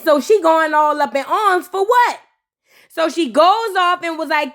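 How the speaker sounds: normal talking voice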